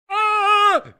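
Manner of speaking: high, sarcastic "ah" of discovery; mock realisation gasp. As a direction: gasp as if suddenly understanding.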